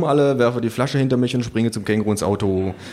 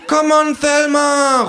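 high, loud call across the pool; kangaroo shouting; 6 dB louder, much slower than usual. Acted. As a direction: yell it like a getaway cue. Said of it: "ruft das Känguru". (laut rufend, sehr hoch)

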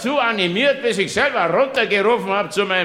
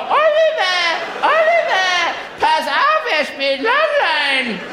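much higher, screeching mock mother's cry; shrill tennis mother; 8 semitones higher, slower than usual. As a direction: shriek her son's name like a frantic tennis mom. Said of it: "Und dann schreit diese Frau". (hohe, kreischende Tennismutter, parodiert)

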